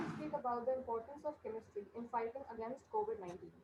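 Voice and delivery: very faint, distant voice; barely audible. Real, not acted. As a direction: voice lost far from the mic. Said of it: "Still, we are unable, unable to listen you".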